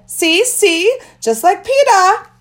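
put-on high, loud, slow little-boy crowing; childish show-off voice; proud toddler boast.

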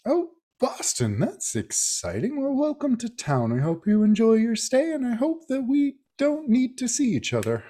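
put-on high, friendly old country doctor. Frank greets Rosemary in the cold, high friendly old man's voice. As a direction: play a cheery older doctor greeting newcomers.

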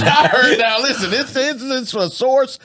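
gossiping through laughter; laughing. Real, not acted. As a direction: giggle while spilling the rumor.

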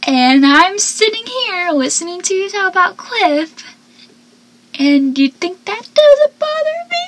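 hammed-up indignant reply; Joey retort. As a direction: play Joey, rising in outrage. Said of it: "Joey's just like".

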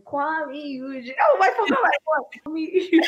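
excited outburst, high and loud; Oh my God, I forgot him; sudden remembering. Real, not acted. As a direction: blurt it out as the name comes back.

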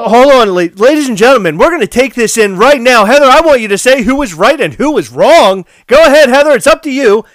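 high, loud game-show host; hammed-up ladies and gentlemen announcer; mock announcer. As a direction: build it up like a host. Announcer calling for a verdict.

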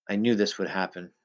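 plain reading voice

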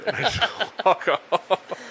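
laughing through the words; slow, laughing delivery. Real, not acted. Laughing while telling.

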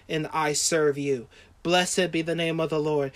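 low, hushed prayer of surrender; tender devotion. Acted. Preacher voices Job pledging love to God, tender devotion. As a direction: speak softly and intimately to God.

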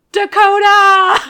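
drawn-out laughing yell of a name; mock yell. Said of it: "he was yelling".

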